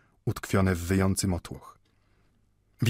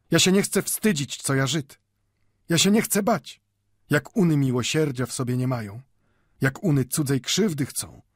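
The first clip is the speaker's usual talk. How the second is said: put-on higher, impassioned old man's declaration; hard, ringing voice; 6 semitones higher than usual. A narrator's reading. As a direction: speak out firmly, pitch lifted, ringing like a bell. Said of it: "mówił twardym, brzmiącym jak dzwon głosem".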